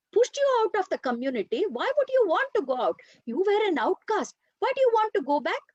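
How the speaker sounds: high, fast, disbelieving outburst; incredulous protest. Acted. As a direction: protest in shock that he would return. Sullivan argues against going back.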